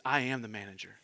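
deadpan, unbothered manager; a hint of smug calm. Manager answers calmly.

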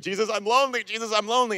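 high, pleading complaint to Jesus; whiny lonely plea. Retold or imitated. Churchgoer complaining to Jesus, high whiny plea. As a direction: whine it like a lonely kid. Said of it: "And you're like".

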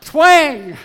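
loud, high mock snapping twang; twang sound. Preacher shows his weak spot giving way, loud mock twang. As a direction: shout it like a tendon snapping. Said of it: "The acute Achilles heel went".

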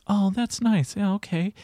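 high, lightly mocked polite approval; placating. Coach mimicking a too-nice response to client, placating. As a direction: imitate a mild, soothing nod-along. Mimics a mild, unhelpful reply.

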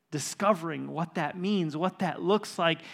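loud, building sermon appeal to the church; preaching. Acted. Pastor challenging his church, preaching loudly. Preaching at full force to congregation.

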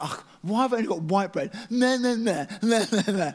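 high whiny moan with laughter; mock moan.

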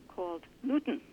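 put-on Newton in a Yiddish accent, heard as Newtown. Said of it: "This is a Yiddish accent".

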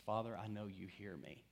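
hushed, soft prayer; whispering to God. Retold or imitated. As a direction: quietly confide it to the Father. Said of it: "whisper that to God".

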